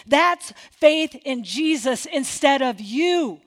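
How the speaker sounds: ordinary talk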